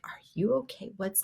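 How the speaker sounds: barely voiced imitation of a friend; imitating mouthed words. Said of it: "she was just mouthing to me".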